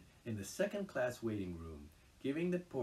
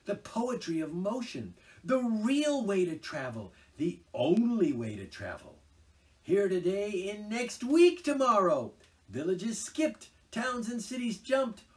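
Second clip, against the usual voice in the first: high, loud, swooning rapture; carried away in giddy bliss; ecstatic rapture; 13 dB louder, slower than usual. Acted. Toad raves in rapture about motoring.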